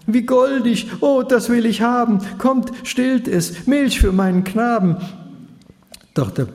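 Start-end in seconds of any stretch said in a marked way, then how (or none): 0.1-5.0 put-on high, bright-eyed princess cooing over a baby; high delighted princess voice. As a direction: coo it like a delighted royal lady.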